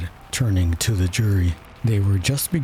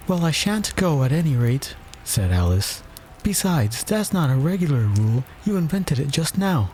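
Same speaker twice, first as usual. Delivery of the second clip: Alice voice; 6 semitones higher than usual. A narrator's reading.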